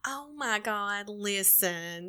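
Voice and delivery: soft, drawn-out Southern apology; mock-apologetic in a slow country drawl. Southerner playing dumb on a call, slow country apology. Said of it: "and talk real slow and real country".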